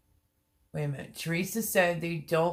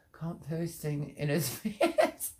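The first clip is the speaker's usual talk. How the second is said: slow, then cracking up laughing; breaking into laughter; slower than usual. Real, not acted. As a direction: lose it laughing on the last words. Reads a funny comment, breaks up.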